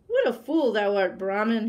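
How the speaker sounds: loud, scornful tiger roar; mocking roar. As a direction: roar it at the fool. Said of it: "he roared loudly".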